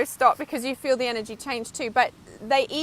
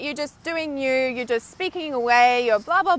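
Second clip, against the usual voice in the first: ordinary talk, nothing marked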